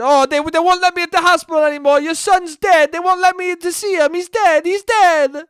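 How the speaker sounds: loud, high hammed-up panic; frantic caller. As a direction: break the news in a frantic mock yell. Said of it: "called her up and said".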